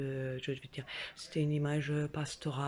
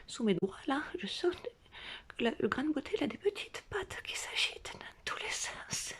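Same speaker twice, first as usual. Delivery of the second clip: hushed whisper climbing higher toward horror; creeping whisper; 7 semitones higher, 5 dB quieter than usual. Retold or imitated. Realising the mole has legs, a rising horrified whisper. Discovering the tick moves.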